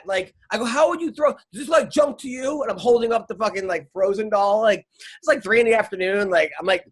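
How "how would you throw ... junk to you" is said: high-pitched retold indignation; mad. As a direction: re-enact an incredulous, annoyed challenge. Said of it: "I'm mad".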